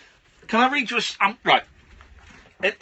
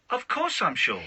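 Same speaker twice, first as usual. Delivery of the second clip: lightly put-on child, pitched up; mock stock reply, raised in pitch; son's cheating voice; 4 semitones higher than usual. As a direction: imitate a kid's pat answer, a little higher. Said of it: "He only says it in that voice".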